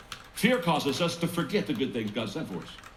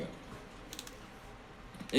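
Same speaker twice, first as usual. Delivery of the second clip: mumbling; 12 dB quieter than usual. Retold or imitated. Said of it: "mumbling to himself".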